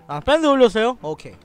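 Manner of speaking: ordinary talk, nothing marked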